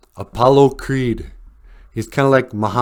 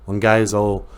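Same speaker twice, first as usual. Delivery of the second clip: normal talking voice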